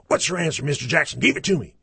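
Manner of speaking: high, loud in-your-face demand; loud teacher voice. Shown as a demonstration. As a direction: shout it at a class of boys. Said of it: "The loud voice".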